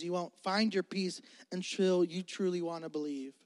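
ordinary delivery

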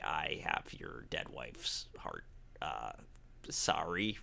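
sheepish whisper. Retold or imitated. She admits she has his dead wife's heart, whispered. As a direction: whisper the awkward confession.

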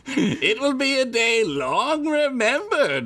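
high, slow sinister wizard. Acted. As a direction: say it like an ominous prophecy. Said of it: "[name] said with a sinister tone".

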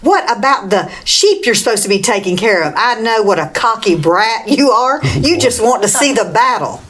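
ordinary delivery

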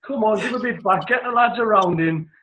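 rally the whole pub, full volume; retold shout. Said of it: "Top of his voice".

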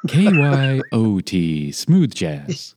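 slow smooth jazz DJ; radio announcer. Retold or imitated. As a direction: croon the call letters slowly. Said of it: "the announcement was".

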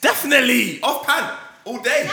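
mock hype. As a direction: sell the phrase like a slogan.

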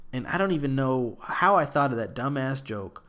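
ordinary delivery

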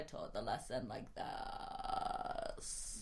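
soft, slow creaky vocal fry; vocal fry teacher voice, drawn out. Shown as a demonstration. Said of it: "I'm gonna do that voice".

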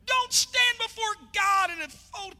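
high, slow sung preaching shout. Real, not acted. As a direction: belt it out in a whoop. Climactic sermon shout.

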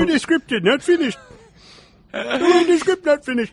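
put-on high goofy Swedish chef accent; squeaky mock Swedish chef voice. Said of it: "The Swedish chef directing Halloween five".

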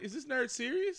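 high, mock disbelief; high incredulous mock question. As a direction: mimic the panel's disbelief. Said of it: "look at him like".

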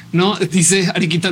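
normal talking voice

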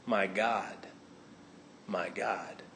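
a touch of despair from the cross; hushed lament of forsakenness; anguished cry. Acted. Jesus on the cross.

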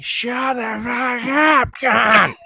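high, long drawn-out shout; mock crowd heckle, stretched out; imitated yelling. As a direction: yell it slowly like a fed-up heckler. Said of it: "people just yelling and shit".

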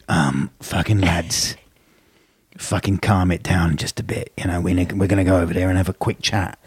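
restrained Cockney hard man; Ray Winston gangster. Pretend. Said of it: "That's so good".

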